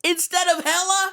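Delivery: high-pitched questioning; mock astonishment. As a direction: ask it high, in put-on disbelief. Mock surprise at the word hecka.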